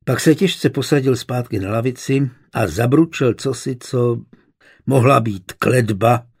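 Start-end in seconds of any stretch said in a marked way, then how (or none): none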